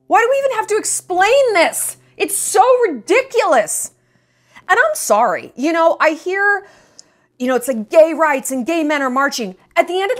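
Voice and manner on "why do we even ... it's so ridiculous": hoger, verontwaardigd uitgeroepen; exasperated. Real, not acted. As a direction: roep het ongelovig en geërgerd uit. Exasperated at having to explain.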